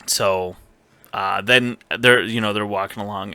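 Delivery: ordinary delivery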